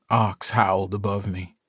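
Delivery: ordinary delivery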